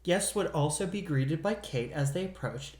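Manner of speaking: ordinary talk, nothing marked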